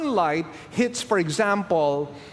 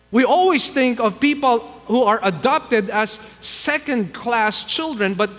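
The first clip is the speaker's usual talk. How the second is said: loud, high, impassioned sermon climax; booming pulpit proclamation; full-force preaching; 6 semitones higher, 5 dB louder than usual. Acted. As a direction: preach it out with rising fire to the congregation.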